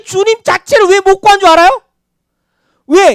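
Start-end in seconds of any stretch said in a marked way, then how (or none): none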